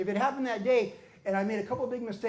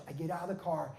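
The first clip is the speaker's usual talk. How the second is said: ordinary talk, nothing marked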